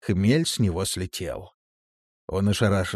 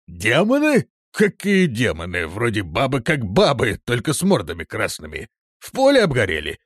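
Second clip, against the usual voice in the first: loud sceptical questioning; incredulous, dismissive reply; disbelieving; 3 semitones higher, 4 dB louder than usual. A narrator's reading. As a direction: scoff at the idea. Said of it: "не поверил Высокий".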